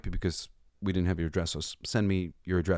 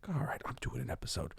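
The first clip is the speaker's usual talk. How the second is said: whispering; 5 semitones higher, 11 dB quieter than usual. Retold or imitated. Retold thought, whispered.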